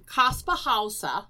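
Kaspar Hauser in a German accent. Shown as a demonstration. Cohost showing how to imagine the name, German accent. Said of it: "is how you should imagine his name".

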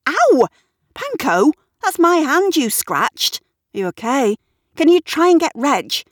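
high, loud yelp into flustered scolding; pained exclamation. A narrator's reading. Scratched by Panko while grabbing animals, flustered and loud. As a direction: cry out, then call for help. Scratched by cat during fight.

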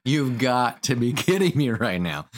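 half laughing the line. Real, not acted. Retelling brother's tattling, laughing in disbelief. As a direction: laugh through the words in disbelief. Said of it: "start laughing like".

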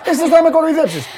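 ελαφρά μιμείται τον αγανακτισμένο σκηνοθέτη, πιο ψηλά και δυνατά; παραπονεμένος σκηνοθέτης, ελαφρά παιγμένος; μίμηση του σκηνοθέτη. Retold or imitated. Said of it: "Αυτά κάνει ο σκηνοθέτης". (σκηνοθέτης που διαμαρτύρεται στη Μαρία, ελαφριά μίμηση)

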